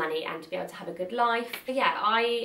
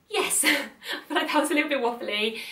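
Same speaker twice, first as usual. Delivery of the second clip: higher and louder, laughing through it; 7 semitones higher, 4 dB louder than usual. Real, not acted. Vlogger admitting to viewers she waffled, laughing. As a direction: giggle at your own rambling.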